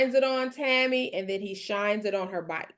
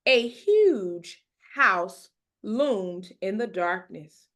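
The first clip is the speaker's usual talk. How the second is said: usual speaking voice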